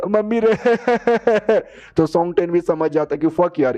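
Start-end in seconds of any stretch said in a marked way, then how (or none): 0.1-1.6 high comic wail for mummy; hammed-up cry-baby wailing; mock crying. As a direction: bawl in staccato, put-on sobs. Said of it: "इनका रोना".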